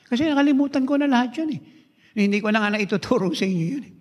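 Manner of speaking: choking up as he admits he has forgotten it; real tearful voice. Real, not acted. Preacher moved to tears before the congregation, voice breaking high. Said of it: "Umiiyak po ako".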